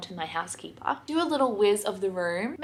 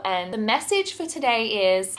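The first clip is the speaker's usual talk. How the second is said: ordinary delivery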